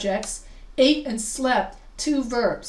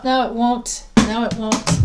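louder, exasperated at the computer; annoyed; 6 dB louder than usual. Real, not acted. Teacher fumbling with a dropped mouse, annoyed. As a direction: grumble at the stuck mouse. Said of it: "Isn't that annoying?".

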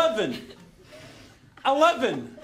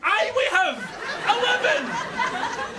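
higher, exasperated shout; angry retort; 8 semitones higher, much slower than usual. Acted. Passenger contradicting the lift, exasperated shout. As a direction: answer the machine back, stretching the last word.

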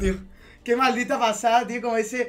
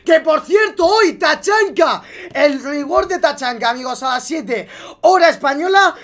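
pumped-up, raised-voice plug; excited announcement; 4 semitones higher, 6 dB louder than usual. Real, not acted. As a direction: shout the news to your viewers.

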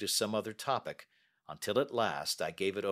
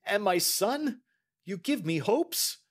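slow, higher, pleading banker; anxious hope; 12 semitones higher, 4 dB louder, slower than usual. A narrator's reading. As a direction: clutch at a hope. Banker voiced while reading.

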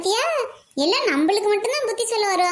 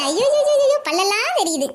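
teasing exclaim; 6 semitones higher than usual. Acted. Teasing the laughing sister.

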